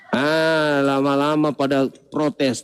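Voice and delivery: ordinary talk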